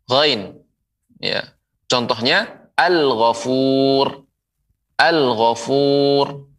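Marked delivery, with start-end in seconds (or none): 1.9-6.5 slow "al-ghafur" with the l said clearly; clear al pronounced. Shown as a demonstration. Teacher modelling "al-ghafur" slowly to viewers. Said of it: "Dibaca dengan jelas".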